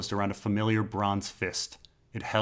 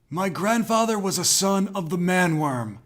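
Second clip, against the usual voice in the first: 1 fearful babble; 9 semitones higher, 3 dB louder than usual. A narrator's reading. Captive Vermalar babbling in fear.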